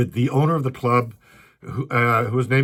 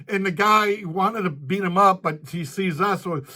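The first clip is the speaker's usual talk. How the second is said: normal talking voice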